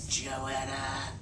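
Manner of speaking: low, soft menacing hiss; an angry mother hissing the name; hissing like a snake. A narrator's reading. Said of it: "hissing like a snake".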